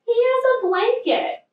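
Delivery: excited call. A narrator's reading. Little Bear tells his friends about Fluff, excited. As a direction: point it out with delight.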